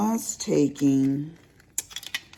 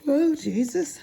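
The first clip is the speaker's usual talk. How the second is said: said through a yawn; 12 semitones higher, 3 dB louder than usual. Real, not acted. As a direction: yawn mid-word, sleepy. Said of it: "I'm all yawning".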